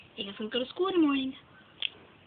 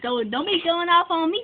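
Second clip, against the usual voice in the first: yelling; 6 semitones higher, 6 dB louder than usual. Acted. Arguing back loudly.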